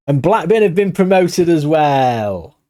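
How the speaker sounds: excited. Real, not acted. Manager celebrates promotion to his viewers, excited and drawn out. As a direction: savour the good news, stretching it out.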